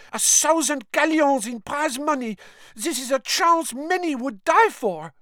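very high-pitched, indignant protest; Fleur Delacour. A narrator's reading. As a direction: argue hotly in a high voice, as if stamping a foot. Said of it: "burst out Fleur Delacour, stamping her foot".

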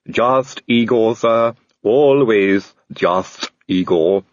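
slow, calm servant voice; steady and unbothered, drawing it out; calm Igor. A narrator's reading. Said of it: "said Igor calmly".

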